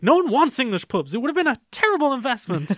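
high, loud defensive snap; snapping chef. Retold or imitated. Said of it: "he sort of snaps".

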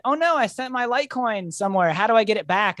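high-pitched mock dismay; retold panic. Retells own panic over lost coins.

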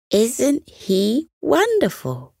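put-on high, slow and enchanted child; delighted boy. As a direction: marvel at the pet. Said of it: "said Elliot".

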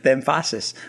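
"The emphasis" com a ênfase deslocada para "The"; lê "The emphasis" acentuando a palavra errada, de brincadeira; mock wrong stress. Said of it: "emphasis on the wrong syllable".

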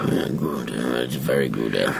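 very low, soft put-on voice; deep mock-connoisseur murmur; silly savouring voice. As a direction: drop deep and quiet to relish it. Savouring cheese name in silly voice.

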